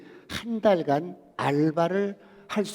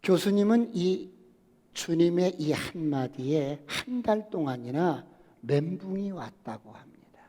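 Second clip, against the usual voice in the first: usual speaking voice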